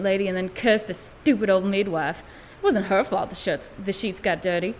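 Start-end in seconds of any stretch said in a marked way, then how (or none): none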